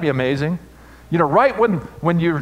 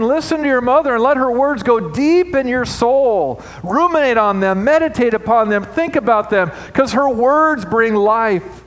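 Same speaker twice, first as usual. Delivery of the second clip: higher, louder scripture preaching; fervent sermon reading; preaching at full force; 11 semitones higher, 6 dB louder than usual. Acted. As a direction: preach the verse to the rafters. Preaching climax.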